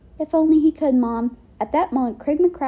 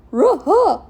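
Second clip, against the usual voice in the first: higher cartoon dog reply; Scooby agreeing in dog talk; Scooby-Doo dog voice; 7 semitones higher, 5 dB louder than usual.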